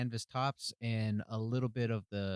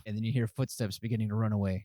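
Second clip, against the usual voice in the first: ordinary talk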